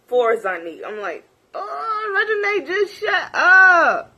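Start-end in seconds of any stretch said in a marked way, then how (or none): 1.5-4.1 high, loud, fed-up yell; exasperated shout. Retold or imitated. Reacting to Reginae talking, exasperated shout. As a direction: blurt it out in disbelief.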